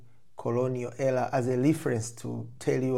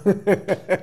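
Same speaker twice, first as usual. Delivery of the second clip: a high, loud, bright laugh; real amused laugh; burst of laughter; 9 semitones higher, 11 dB louder than usual. Real, not acted. Laughing at the role switch.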